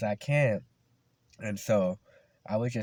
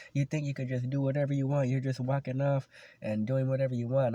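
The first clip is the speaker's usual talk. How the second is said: fast, high-pitched mock telling-off; retold yelling teacher; 5 semitones higher than usual. PE teacher scolding a student for walking off, retold yell. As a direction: imitate a coach ranting at a slacker. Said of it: "apologize for about yelling at you".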